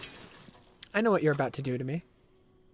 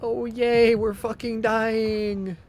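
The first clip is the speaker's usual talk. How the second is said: loud, higher mock cheer, dragged out; sarcastic cheer; 6 semitones higher, 5 dB louder, much slower than usual. Real, not acted. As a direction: cheer like it's the worst news. Sarcasm at Leon's worsening health.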